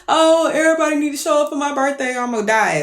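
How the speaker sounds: high, rushed mock whine; demanding drama queen. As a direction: mimic an over-dramatic birthday girl. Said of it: "she's one of them".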